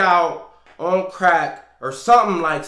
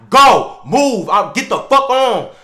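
much louder, higher, slow shouted command; furious yell; 7 semitones higher, 11 dB louder, slower than usual. Retold or imitated. Man pointing a gun out the window, furious yell. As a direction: scream her away from the door.

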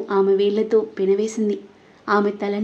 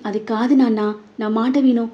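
usual speaking voice

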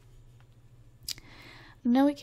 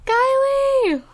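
a genuine high-pitched panic cry; real panicked screaming; 10 semitones higher, much slower than usual. Real, not acted. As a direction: scream for Kylie in alarm. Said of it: "what you screaming about, girl?".

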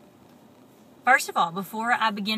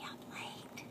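whisper; 18 dB quieter than usual. Real, not acted. Whispered aside.

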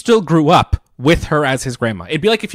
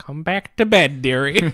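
higher put-on granny voice; little old granny; 5 semitones higher, slower than usual. Knitting Mildred calls Fry back, imitated old-lady coo. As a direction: coo like a cartoon grandmother. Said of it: "little glasses and her hair in a bun".

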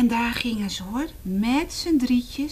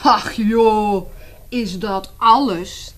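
laughing bear; 8 dB louder, slower than usual. Acted. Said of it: "lachte de beer".

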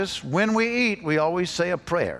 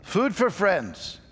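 put-on slow, higher call for kids to echo; good and loud; 5 semitones higher, much slower than usual. Shown as a demonstration. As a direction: lead a loud, drawn-out group chant. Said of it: "good and loud".

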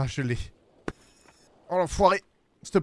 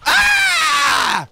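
hurlement très aigu et fort de frustration; un « Ah ! » qui explose; cri de rage; 8 dB louder than usual. Real, not acted. Tombe encore, excédé. (hurle de rage après une nouvelle chute)

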